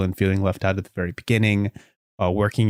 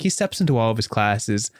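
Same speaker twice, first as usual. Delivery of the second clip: normal talking voice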